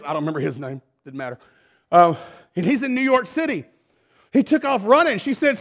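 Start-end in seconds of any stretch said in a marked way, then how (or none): none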